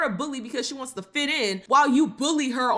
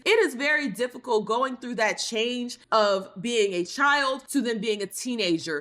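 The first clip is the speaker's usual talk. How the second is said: ordinary delivery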